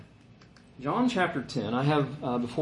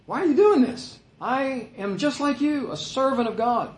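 high-pitched, incredulous protest; acted remonstrance; 10 semitones higher than usual. Angel to John bowing before him, acted remonstrance. Angel refuses John's worship.

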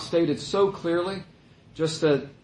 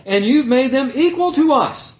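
high, rising whine that gets louder at the end; grumbling laborer; 9 semitones higher, 7 dB louder than usual. Retold or imitated. Laborers accusing the master, rising whiny protest. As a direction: complain like you've been cheated. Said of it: "they grumbled at the master, saying".

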